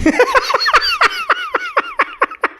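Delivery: high burst of real laughter. Real, not acted. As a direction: crack up laughing. Big burst of laughter after joke.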